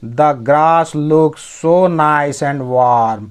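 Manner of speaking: very slow, word-by-word English; slow dictation pace; slow reading. Shown as a demonstration. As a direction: read each word out slowly for learners. Teacher reads story line aloud.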